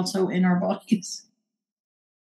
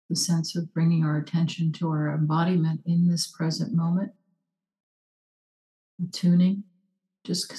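guided meditation; 2 semitones lower, 3 dB quieter than usual. Acted. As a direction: hush down and guide them inward. Leads a short meditation.

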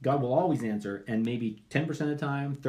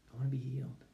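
quiet request; 8 dB quieter, faster than usual. Acted. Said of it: "beggar answers Jesus".

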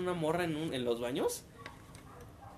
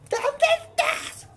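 imitated mom, much higher, louder and very slow; worried mom; 9 dB louder, much slower than usual. Mom phoning son after quake, high anxious drawn-out. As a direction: stretch it out like an anxious mother. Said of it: "Y mamá".

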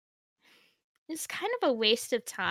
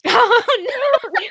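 high laughing gasp; 12 semitones higher, 9 dB louder than usual. Real, not acted. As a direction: burst out laughing in shock. Horrified laughing reaction.

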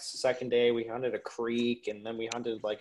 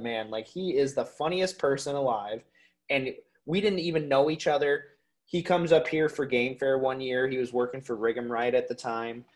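ordinary talk

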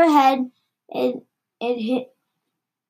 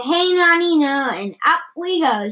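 higher-pitched grandma cheer; mild shout; 4 semitones higher than usual. A narrator's reading. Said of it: "she shouted".

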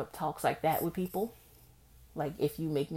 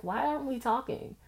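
casual make-up question; 9 semitones higher than usual. Retold or imitated. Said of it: "maybe a week later be like".

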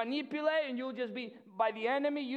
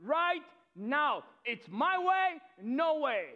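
higher, slow insistent demand; demanding refusal; 5 semitones higher, 4 dB louder, slower than usual. Retold or imitated. Amnon overriding Tamar, slow insistent demand. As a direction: deny her, stretching each word. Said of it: "He said".

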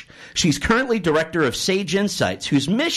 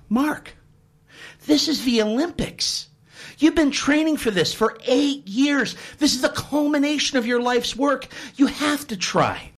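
higher, earnest pep talk; urging coach; 8 semitones higher than usual. Acted. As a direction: coach pleading with his swimmer to try.

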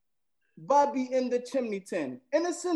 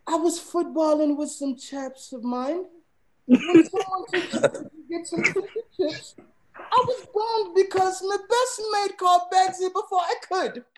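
a higher, almost feminine British accent; 9 semitones higher than usual. Shown as a demonstration. Using Bagsy in a sentence for friends, high British accent. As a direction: play a posh British lad with a lifted voice. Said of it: "Why is your British accent almost more female".

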